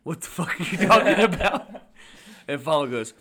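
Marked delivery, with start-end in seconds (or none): 0.0-1.8 incredulous. Retold or imitated. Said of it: "Sean's like".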